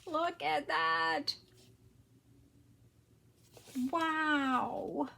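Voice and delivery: loud, high, drawn-out marvelling; amazed. Real, not acted. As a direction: stretch it out in delighted awe. Marvelling at unboxed gift.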